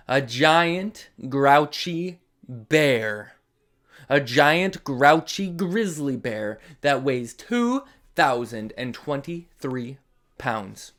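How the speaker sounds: ordinary delivery, nothing marked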